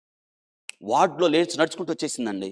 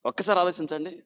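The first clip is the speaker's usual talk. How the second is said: normal talking voice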